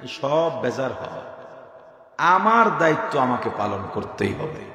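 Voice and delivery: ordinary talk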